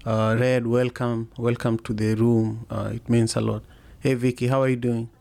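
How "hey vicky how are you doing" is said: usual speaking voice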